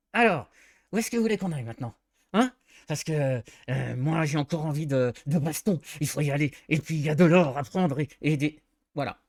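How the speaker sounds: jittery little goblin hissing through clenched teeth; nervous goblin. Shown as a demonstration. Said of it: "On monte la voix ... rythme un peu rapide ... serre les dents".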